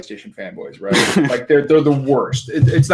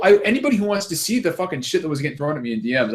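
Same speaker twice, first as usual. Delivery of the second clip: usual speaking voice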